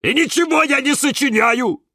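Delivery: high, loud offended denial. A narrator's reading. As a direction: jump in, stung and protesting. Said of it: "подскочил обиженный Кирилл". (обиженный выкрик)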